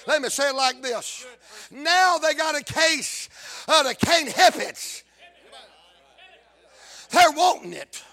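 loud, punched revival delivery in short bursts; forceful preaching. Real, not acted. Preacher telling of hunger to stay around the fire, forceful preaching. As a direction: drive each phrase home to the congregation.

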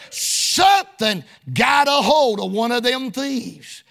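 normal talking voice

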